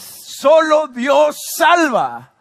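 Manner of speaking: high, very drawn-out cry; triumphant, stretched proclamation; climactic preacher's shout. Real, not acted. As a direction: shout each word slowly to the whole church. Climactic repeat of key phrase.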